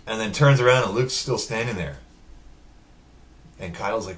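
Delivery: usual speaking voice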